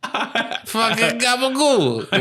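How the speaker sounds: put-on high, slow New York Italian accent, laughing. Laughing friend mocks the movie's New York Italian accent. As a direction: stretch gabagool out like a New Jersey mobster. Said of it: "It was a pretty thick accent".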